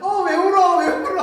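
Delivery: loud, very high mock-innocent child; feigned concern. Secret bully to the crying baby, feigned concern. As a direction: cry out as if you had no part in it.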